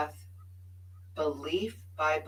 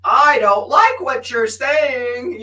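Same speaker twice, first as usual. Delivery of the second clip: high, loud, slow indignant protest; loud stubborn pushback; slow, hammed-up defiance; mock defiant; 7 semitones higher, 16 dB louder, slower than usual. Quoting her younger self's resistance.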